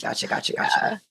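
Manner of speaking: 3 breathe it out under your breath; 1 whisper. Real, not acted. Quiet aside during photo talk.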